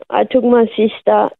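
ordinary talk

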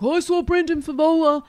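high, mock-protesting husband's voice. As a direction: squeal it as a husband making excuses.

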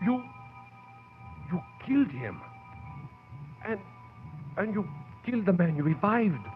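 high, soft, halting accusation; shocked disbelief. Acted. Assistant facing the professor after the shooting, shocked and halting. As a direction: falter through it in stunned horror.

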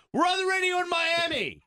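shout. Real, not acted. Emphatic punchline to co-host.

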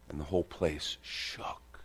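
slow breathy hush; awed whisper. Real, not acted. Preacher telling the congregation the place shook, in a whisper. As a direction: hush it in awe. Awe after the seraphim's cry.

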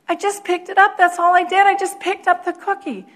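high-pitched, protesting imitation of a daughter; defensive daughter. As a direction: insist on your innocence in a high voice. Upset daughter defends herself to mom.

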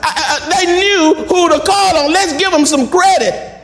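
ordinary delivery

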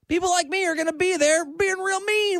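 usual speaking voice

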